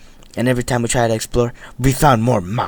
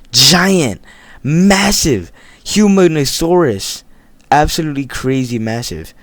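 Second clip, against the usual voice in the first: hyped up; 5 semitones higher, 5 dB louder, slower than usual. Real, not acted. Hyping up giant mine shaft.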